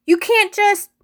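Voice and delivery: high, loud cut-off protest; boy lashes out at the teacher, loud and high; angry outburst. Acted. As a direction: blurt it out in anger. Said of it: "I felt a twinge of anger".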